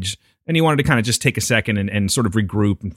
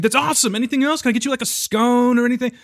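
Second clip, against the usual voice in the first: overexcited barista; 12 semitones higher than usual. Retold or imitated.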